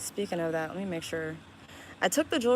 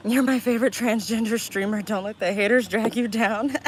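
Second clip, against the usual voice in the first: loud read-out; 2 semitones higher, 7 dB louder than usual. Retold or imitated. Reading a chat message aloud.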